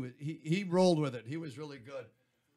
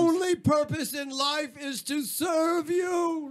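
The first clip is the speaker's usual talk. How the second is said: high, hammed-up declaration of devotion; mock servile proclamation; 8 semitones higher than usual. Host playfully pledging service to the queen, mock-grand proclamation. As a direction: proclaim it grandly, pitch climbing to the last word.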